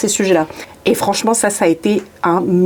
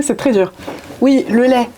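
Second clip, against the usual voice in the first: ordinary delivery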